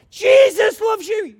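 high, loud, slow cry of faith; shouted proclamation. Retold or imitated. Preacher shouting his faith at the factory. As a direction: declare it with everything.

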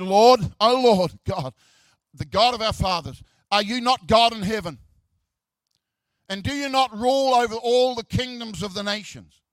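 fervent prayer. Acted. Reading the king's prayer aloud.

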